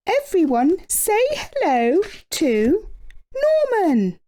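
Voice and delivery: high, sing-song introduction; queen voice. A narrator's reading. Queen introducing Norman to the class, high sing-song. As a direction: present the new pupil grandly.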